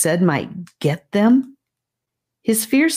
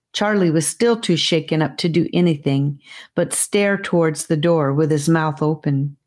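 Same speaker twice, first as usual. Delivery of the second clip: ordinary delivery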